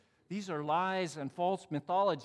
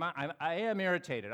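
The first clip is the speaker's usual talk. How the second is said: ordinary talk, nothing marked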